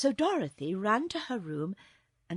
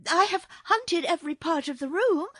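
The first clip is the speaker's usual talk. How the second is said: high, loud protest of a maid; insistent maid; 10 semitones higher, 9 dB louder than usual. A narrator's reading. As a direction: insist in a raised, higher voice. Maid insists she searched everywhere.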